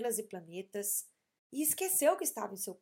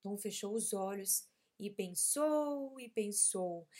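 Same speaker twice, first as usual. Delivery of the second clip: usual speaking voice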